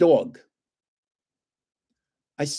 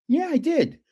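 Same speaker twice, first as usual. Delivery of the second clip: much higher, retold insistence; insistent protest; 11 semitones higher than usual. To the dog warden, high insistent protest. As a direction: insist you really did.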